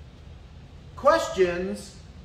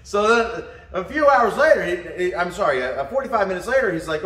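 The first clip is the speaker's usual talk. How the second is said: usual speaking voice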